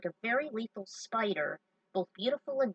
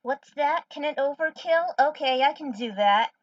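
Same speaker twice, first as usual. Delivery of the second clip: loud playful reply; 5 semitones higher, 8 dB louder than usual. Real, not acted. Loudly answering the game character.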